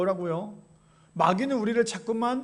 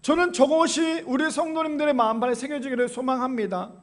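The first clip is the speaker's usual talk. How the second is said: ordinary delivery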